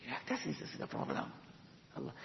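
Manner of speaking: a breathy hushed echo of the point; whispered aside. Real, not acted. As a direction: whisper it to yourself. Repeats the elder's point.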